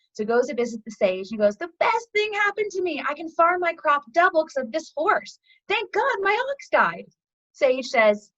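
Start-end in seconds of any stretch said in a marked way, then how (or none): 1.6-7.1 high-pitched gushing joy; thrilled retelling, voice lifted high; acted elation. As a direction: gush as an overjoyed farmer, pitched well up. Farmer rejoices in parable.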